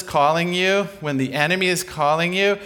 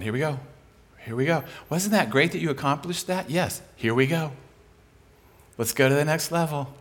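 softer, gentle urging; encouraging shepherd; 5 dB quieter than usual. Retold or imitated. Preacher voicing the shepherd urging his sheep, softer. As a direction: coax them onward, softly.

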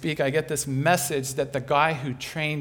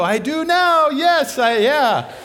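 much higher and much louder, laughing; sheepish laughing admission; 10 semitones higher, 11 dB louder, slower than usual. Retold or imitated. Speaker admitting to the neighbor, loud laughing.

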